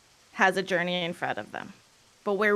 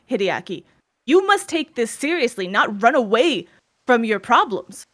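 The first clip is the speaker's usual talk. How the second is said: scolding father; 3 semitones higher, 7 dB louder than usual. Acted. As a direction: berate the son for running away.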